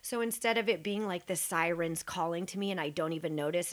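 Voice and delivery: usual speaking voice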